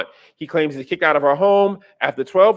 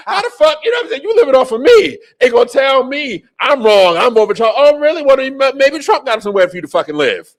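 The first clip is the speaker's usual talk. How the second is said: loud, high-pitched acted outrage; indignant retort; 6 semitones higher, 5 dB louder than usual. Aunt scolding the nephew who lives off her, loud indignation. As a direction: tell off a freeloading relative at full volume. Acting out the aunt's angry retort.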